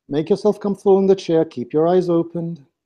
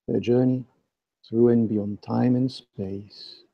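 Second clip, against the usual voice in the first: right in your ear; 6 semitones lower, 4 dB quieter than usual. Acted. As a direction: guide them inward, hushed and close. Leads guided meditation with breathing.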